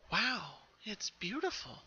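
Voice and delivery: high, slow, hushed wonder; gasped in awe. Acted. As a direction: breathe it out in amazement. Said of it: "Pinkie gasped".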